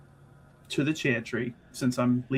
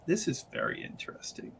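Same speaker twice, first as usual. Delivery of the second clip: hushed musing; 13 dB quieter than usual. Acted. Character muses over mask aloud.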